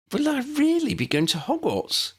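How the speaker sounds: high excited squeak. Acted. Young Lydia asking about Hogwarts, high excited squeak. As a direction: squeak it with childlike wonder. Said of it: "Lydia squeaked".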